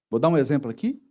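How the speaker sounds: usual speaking voice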